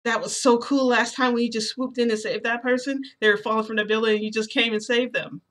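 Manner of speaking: excited gushing. Acted. Midoriya praises the narrator for saving people, gushing. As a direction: gush admiringly about a rescue. Midoriya gushes about a rescue.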